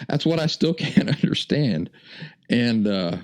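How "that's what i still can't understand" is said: talking through laughter, pitched up; high, amused and baffled; laughing. Real, not acted. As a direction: laugh in disbelief while speaking. Laughing at his own puzzling story.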